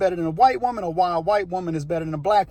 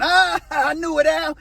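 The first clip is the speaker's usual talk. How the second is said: retold gloating laugh, a bit higher; 7 semitones higher than usual. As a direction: laugh through I knew it. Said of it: "then Jim starts laughing".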